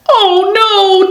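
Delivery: high, mildly frightened cry; acted fright; trembling fear. A narrator's reading. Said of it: "tremble Eddie, Rosie, and B B".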